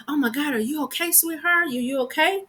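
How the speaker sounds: high, sweet voice of a worried stranger; concerned lady. Retold or imitated. Imitating a concerned lady asking a stranger if she's okay. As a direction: coo it like a concerned lady checking on you. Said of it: "this lady walks up to me and she's like".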